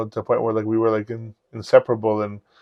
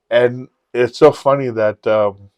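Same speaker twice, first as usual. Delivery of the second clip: ordinary delivery, nothing marked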